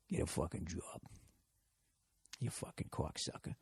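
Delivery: very soft, low Boston mumble; mumbling. Pretend. Cubicle worker freaking out coworkers, mumbling to himself. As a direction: mumble it under your breath, barely voiced. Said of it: "just mumbling".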